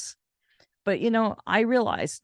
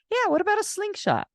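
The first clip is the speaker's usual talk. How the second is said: much higher, bright insistence; gleeful childlike pitch to dad; eager insisting; faster than usual. Retold or imitated. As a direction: push the idea up high and eager.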